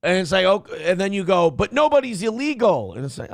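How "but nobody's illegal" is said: high-pitched sloganeering; mock righteous cry. Mimics the same activists contradicting themselves.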